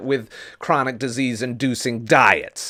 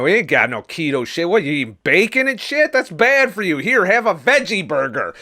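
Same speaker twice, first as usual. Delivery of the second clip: mocking party hosts; 7 semitones higher than usual. Party host scolding the keto guest, in a high mock sneer. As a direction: sneer as the preachy host pushing veggie burgers.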